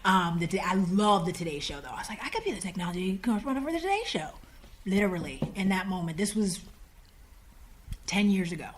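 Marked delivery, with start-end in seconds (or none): 2.2-4.3 soft, very fast burst; rushed excitement. Retold or imitated. Applicant seizing on her dream job, rushed excitement. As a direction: blurt it in one quick run.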